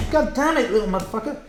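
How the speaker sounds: high-pitched cursing at a bug; annoyed curse. Real, not acted. Streamer cursing the bug that just flew at him. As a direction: snap at the little pest.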